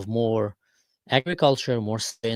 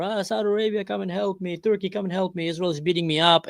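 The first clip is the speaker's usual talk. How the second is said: higher mock whine calling on allies; whiny call for help; 6 semitones higher than usual. Beaten Syria calling on Saudi Arabia, mock higher whine. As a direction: play the bully suddenly whimpering for help. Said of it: "he starts calling for".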